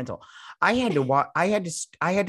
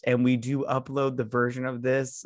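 ordinary talk, nothing marked

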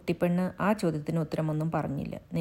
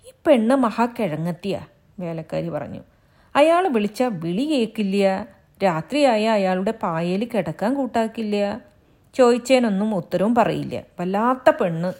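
higher and louder, complaining maid; exasperated maid, raised voice; grumbling servant woman; 4 semitones higher, 7 dB louder than usual. Acted. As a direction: scold the silly girl to the household. Maid complains about the girl.

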